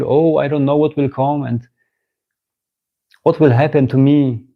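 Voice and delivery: ordinary talk, nothing marked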